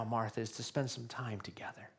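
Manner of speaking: hushed whisper; whispered invitation. Retold or imitated. Preacher voicing Jesus inviting Martha, whispered. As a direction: whisper it close and tender.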